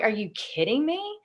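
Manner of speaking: can't believe what she just heard; retold disbelief. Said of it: "And I'm like".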